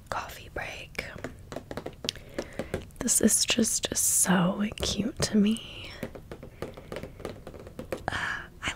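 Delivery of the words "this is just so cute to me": normal talking voice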